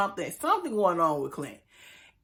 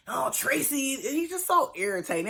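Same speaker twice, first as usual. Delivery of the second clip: a hint of a whiny mock lover's sigh; mocking dramatic Clint; 7 semitones higher than usual. Host mocks dramatic Clint moaning after his wife, lightly whiny. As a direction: lightly mimic him pining, a touch higher. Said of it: "did you notice how dramatic Clint is".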